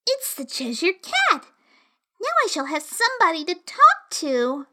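put-on high, relieved little girl; pleased girl spotting a friend; young girl voice. A narrator's reading. Alice voiced in story.

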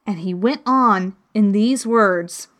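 usual speaking voice

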